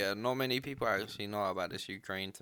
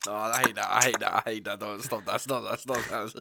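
loud annoyed; 8 dB louder than usual. Real, not acted. Reacting to eating noise.

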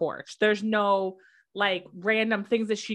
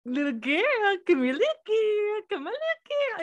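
coaxing the dog; 9 semitones higher than usual. Retold or imitated. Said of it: "And I'm over here like".

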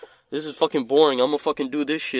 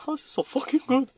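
high, laughing gush; giggly gushing; 11 semitones higher than usual. Retold or imitated. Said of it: "I was like".

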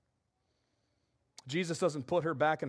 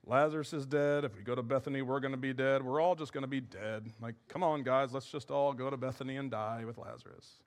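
gloomy Thomas; 6 semitones lower, 3 dB quieter than usual. Retold or imitated. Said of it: "That's how he said it".

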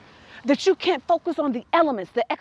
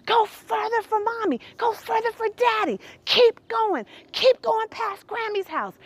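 higher, eager encouragement; cheering her on; 8 semitones higher than usual. Retold or imitated. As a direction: urge her to go further. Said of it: "I could say".

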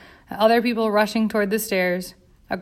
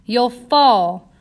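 louder frantic warning; screamed warning; 3 semitones higher, 7 dB louder than usual. A narrator's reading. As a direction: shout it to stop her falling. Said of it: "Bella screamed at her".

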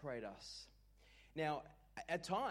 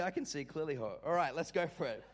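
ordinary delivery

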